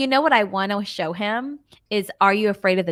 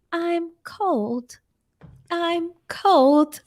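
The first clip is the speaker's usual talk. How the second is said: haunt them in an eerie, raised voice; creepy ghost voice; 6 semitones higher, much slower than usual. Acted. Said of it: "in this really creepy voice".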